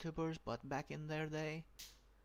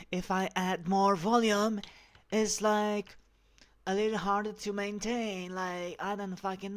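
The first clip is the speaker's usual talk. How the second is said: a bit higher, louder feminine voice; louder feminine voice test; 3 semitones higher, 9 dB louder than usual. Shown as a demonstration. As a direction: push more volume into the feminine voice. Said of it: "when I talk like this".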